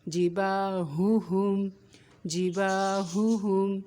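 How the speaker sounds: slow جی با ہو ہم with heavy ha; weight both ha's in جی با ہو ہم; heavy ha sounds. Shown as a demonstration. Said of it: "ہا کی آواز بھاری ہوگی". (جی با ہو ہم، ہا کی آواز بھاری)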